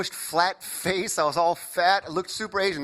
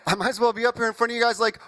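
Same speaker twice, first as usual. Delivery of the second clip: normal talking voice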